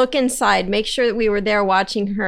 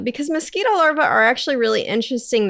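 normal talking voice

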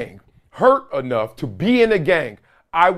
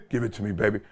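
low echo; 9 semitones lower, 10 dB quieter, faster than usual. Real, not acted. Same words said low and soft.

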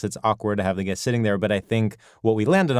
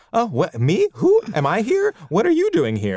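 higher, innocent who-me reaction; mock surprise; 8 semitones higher than usual. Shown as a demonstration. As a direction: play dumb, startled and pleased. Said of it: "you sort of wanna yes and and be like".

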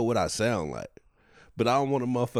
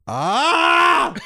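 high, loud cartoonish yell; video game yell; 14 dB louder than usual. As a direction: belt out a game character's scream. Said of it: "you sound like a video game".

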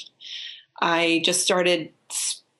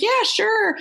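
mock eager yes.

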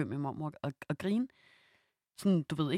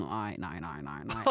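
lightly imitated brother; 11 semitones lower, 7 dB quieter, slower than usual. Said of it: "og var sådan".

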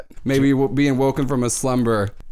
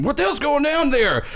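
high-pitched yell from an irate dad; acted parental bellow, voice shooting up; dad's mock scream. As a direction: holler it like a dad at the kids. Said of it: "Jerry's dad just scream".

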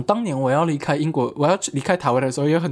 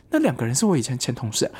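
ordinary delivery

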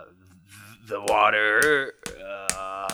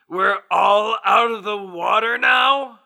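incredulous; 8 semitones higher than usual. Acted. Incredulous echo of own line.

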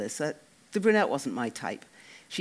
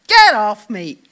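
angry shove-off; 6 semitones higher, 16 dB louder, slower than usual. Acted. Dec shoving friend away.

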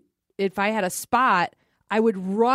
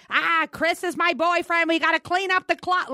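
put-on high thick Boston accent; imitating a thick Boston accent, pitched up; 8 semitones higher than usual. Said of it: "with that thick Boston accent".

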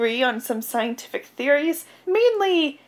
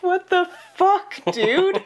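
mock shocked; 8 semitones higher, 3 dB louder than usual. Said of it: "he's like".

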